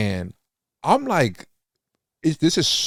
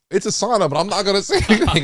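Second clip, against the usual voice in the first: much higher and louder, laughing through it; laughing quote; 12 semitones higher, 8 dB louder, faster than usual. Retold or imitated. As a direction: crack up while quoting him, faster. Friend quoted admitting he's hot.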